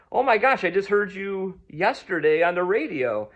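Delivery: loud, high retold amazement; amazed recognition. Fan meeting poet heard on the radio, amazed.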